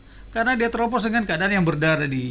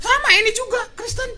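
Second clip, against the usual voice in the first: quoting her higher, breaking into laughter; laughing. Retold or imitated. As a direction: giggle through the quote. (mengutip sambil tertawa)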